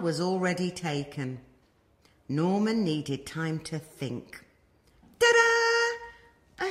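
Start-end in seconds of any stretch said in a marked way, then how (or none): 5.2-6.7 high, loud squeaky ta-da; proud reveal. Acted. Norman proudly shows the snails his new shell, high and bright. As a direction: present it like a magic trick.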